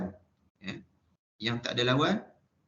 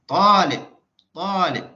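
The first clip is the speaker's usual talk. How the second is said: "Talib" with the first syllable "Ta" high, loud and held long; Arabic-style stress; 7 semitones higher, 10 dB louder, slower than usual. Shown as a demonstration. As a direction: punch and stretch "Ta", let "lib" drop.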